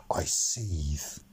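low, soft whisper through jealousy; seething whisper. A narrator's reading. Narrator seethes as Phil praises Key, whispering. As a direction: hiss it quietly under your breath.

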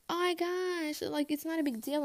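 imitating a friend, high and exasperated; mock dismissive friend. Friend answering her anger, high mock dismissal. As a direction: wave it away in a high mocking voice.

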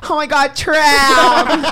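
hammed-up high slow dramatic cry; dramatic English. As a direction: wail it like a trapped damsel. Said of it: "agregar el oh my God porque es como".

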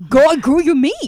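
loud, high cheer for a TV heroine; loud fangirl cheer at the screen. Retold or imitated. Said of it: "I'm like".

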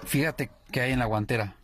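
voz más grave y tranquila para calmarla; calmando a la novia. Acted. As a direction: consuela con calma. Novio calma a novia llorando. (novio calma a la novia con voz grave y suave)

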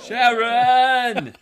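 high laughing yell of Sharon; Ozzy yelling Sharon. Retold or imitated. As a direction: bellow the name, cracking up. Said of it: "channeling his inner [name]".

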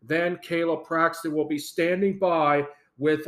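ordinary talk

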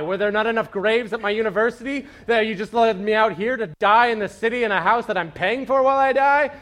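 rising into a loud, high panicked complaint; panicked graduate. Retold or imitated. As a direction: spiral into panic over the bills. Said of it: "you're like".

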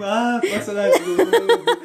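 slow, mocking laugh through it; mock her bored remark; mocking dismissive tone. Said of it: "você falou de um desânimo".

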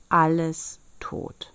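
ordinary delivery, nothing marked

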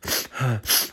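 soft wet snuffles, imitating a crier; imitated tearful sniffling. Imitating the man bawling in line behind, sniffling. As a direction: mimic someone snuffing up everything. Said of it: "like snuffing, like snuffing up everything".

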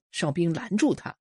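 ordinary delivery

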